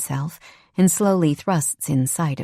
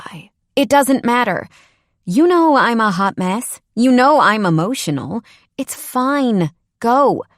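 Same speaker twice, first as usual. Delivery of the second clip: raised, hurt and defensive; upset outburst; 6 semitones higher, 5 dB louder than usual. Acted. Sarah telling Ethan to go, a raised hurt outburst. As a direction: push him away loud and high.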